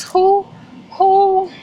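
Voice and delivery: high, loud disbelieving who, who; can't believe someone would be so generous; incredulous who. Real, not acted. Gushing to viewers about a kind subscriber, high incredulous who.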